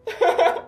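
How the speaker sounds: put-on high-pitched girlish giggle. Girls giggling together over Mika's lucky match, delighted.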